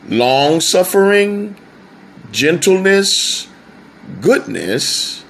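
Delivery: Long suffering and gentleness lifted high; list emphasis. Shown as a demonstration. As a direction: raise the voice on each virtue. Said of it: "something I'd like to do with that verse".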